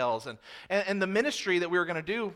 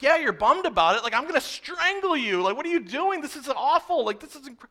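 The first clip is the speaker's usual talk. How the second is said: high, loud mock rage; hammed-up incredulous rant, pitch leaping up; furious outburst; 8 semitones higher, 5 dB louder than usual. Youth leader blows up at bummed friend, exaggerated fury. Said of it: "I got very red".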